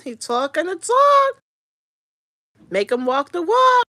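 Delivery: loud, slowed emphatic call; hype shout. Real, not acted. Streamer urging Diamond to test Cap, loud hype. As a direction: egg her on at the screen. Hyping the show's contestant.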